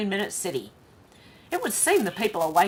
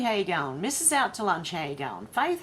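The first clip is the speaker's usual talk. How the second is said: ordinary talk, nothing marked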